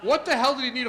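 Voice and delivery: loud, high, disbelieving rant; loud exasperated thought. Retold or imitated. Thinking about the photo ID rule, in a loud exasperated rant. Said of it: "And I'm thinking".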